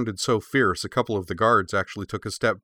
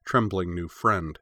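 plain reading voice

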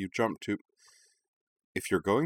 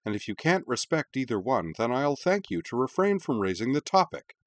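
firm anger; 6 semitones higher than usual. Acted. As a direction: shut the topic down hard. Heated argument with her mother.

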